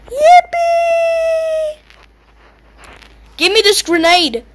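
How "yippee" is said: a shrill victory whoop; gleeful cheer. Real, not acted. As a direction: squeal it out in triumph.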